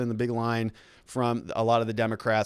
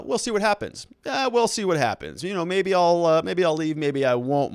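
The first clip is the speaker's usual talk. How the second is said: high, loose imitation of Trump; Donald Trump impression; 5 semitones higher than usual. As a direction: shrug it off like Trump, pitched up. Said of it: "He comes out and he says".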